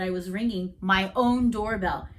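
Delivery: ordinary delivery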